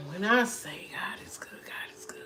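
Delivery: unvoiced church call and response; whispered call. To stream viewers, a whispered church call.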